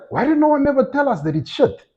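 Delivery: high-pitched disbelieving complaint, pitch well up; exasperated complaint. Retold or imitated. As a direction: retell a friend's shocked outrage. Friend complaining about dead goats.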